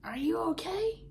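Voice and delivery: lightly hammed-up worried friend; concerned girlfriend. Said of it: "She's like".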